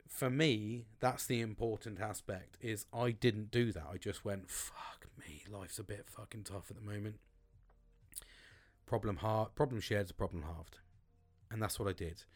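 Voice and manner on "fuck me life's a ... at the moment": breathy whispered fuck me; much softer, whispered admission; whispered aside. Retold or imitated. Retelling his quiet confession.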